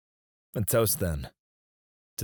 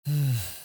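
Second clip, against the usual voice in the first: short falling sigh; contented sigh; 5 semitones higher than usual. Acted. Boss eating dinner with assistant, sighing. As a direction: breathe out over good food. Boss sighs over the meal.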